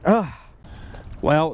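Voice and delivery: ordinary delivery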